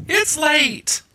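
high-pitched protest; squeaky self-defence; whiny excuse. Real, not acted. Excuse for fumbling the name.

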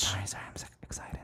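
fast embarrassed whisper. Real, not acted. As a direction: drop to a quick apologetic whisper. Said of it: "Bring it down a notch".

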